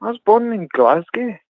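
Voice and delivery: high mock Scottish voice; Scottish accent. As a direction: insist in put-on Scots. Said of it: "talk with my Scottish accent".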